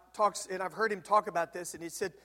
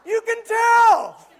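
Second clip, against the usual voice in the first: much higher, very loud, slow drawn-out shout; triumphant shout; 17 dB louder, much slower than usual. Retold or imitated. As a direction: belt the punchline slowly. Triumphant punchline.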